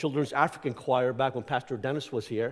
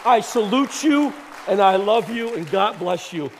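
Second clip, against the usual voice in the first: loud, high, heartfelt salute over applause; raised voice, each phrase punched out and falling; calling out thanks; 6 semitones higher, 6 dB louder, slower than usual. Real, not acted. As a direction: project gratitude across a clapping room.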